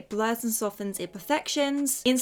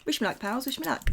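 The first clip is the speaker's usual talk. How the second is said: ordinary talk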